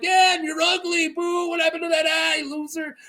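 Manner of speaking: high-pitched mock jeering; heckling. Carl not fazed, heckling Euron, high jeering voice. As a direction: pelt him with taunts from the back. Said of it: "he just starts heckling".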